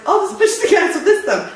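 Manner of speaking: high, breezy inner voice; carefree kid voice. Retold or imitated. Thirteen-year-old deciding to steer crossed-armed, high and carefree. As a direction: sound pleased with a bad idea. Retold childhood decision.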